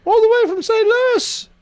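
high-pitched British character voice; British accent. Acted. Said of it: "but a British accent nonetheless".

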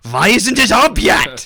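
loud, high mock-German demand; mock German shout. Co-host voices woman grumbling about a late podcast, mock German shout. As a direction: bark it like a cartoon German. Imitates his German-seeming wake-up scolder.

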